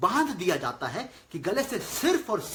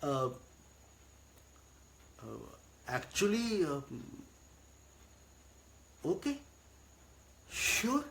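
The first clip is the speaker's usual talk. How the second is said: stalling, then reluctantly agreeing; halting fillers and long pauses before a soft yes; hesitant consent; 5 semitones lower, 8 dB quieter, much slower than usual. Acted. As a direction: give in to the boss haltingly. Employee giving in to boss's weekend request.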